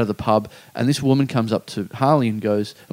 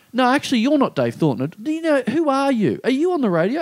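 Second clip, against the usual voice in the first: much higher, accusing questions; suspicious fan; 11 semitones higher than usual. Retold or imitated. Fans backing off from Dave, high accusing questions. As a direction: back away and accuse him.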